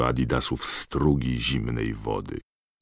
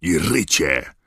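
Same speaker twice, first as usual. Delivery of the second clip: raised, angry call; angry thundering; 4 semitones higher, 4 dB louder, slower than usual. A narrator's reading. Professor catches the poltergeist, thundering angrily. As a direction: thunder out the culprit's name. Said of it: "zagrzmiał rozeźlony głos".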